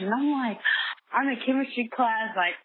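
normal talking voice

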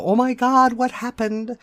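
high, loud alarmed cry; imitated panic. Mother mimicking a frantic arrival at the wreck, high and loud. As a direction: play the frantic parent running up. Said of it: "or go".

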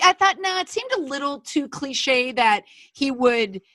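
ordinary talk, nothing marked

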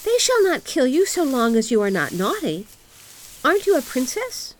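put-on high, forceful little girl voice; little robber girl. A narrator's reading. Robber girl embraces Gerda, high and assertive. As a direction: speak up high, like a bossy child. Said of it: "She threw her arms around Gerda and said".

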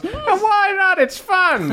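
gleeful mad scientist; mad doctor voice. Acted. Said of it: "waves his hand and goes".

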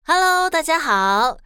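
ordinary delivery, nothing marked

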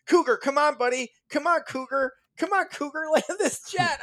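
high, half-laughing mock pilot plea; mock pleading pilot. Retelling the pilot pleading with Cougar, high and laughing. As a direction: coax the buddy pilot, laughing. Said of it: "every time they're like".

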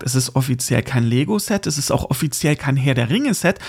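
ordinary delivery

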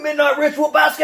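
high excited shout of the name; loud, high hype announcement of the basket. Real, not acted. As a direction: call out the dish name like a game-show reveal.

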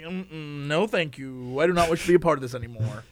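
quiet, fast no thank you; soft hurried mock decline; polite refusal. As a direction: back away politely, quickly. Quoted inner refusal.

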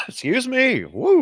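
coughing, then a high breathless woo; recovering after tea went down wrong; swallowed wrong way. Real, not acted. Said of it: "tea went down the wrong pipe".

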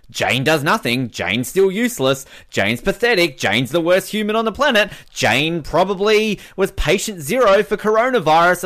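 mocking rant. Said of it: "questioning Jane".

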